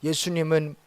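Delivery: ordinary talk, nothing marked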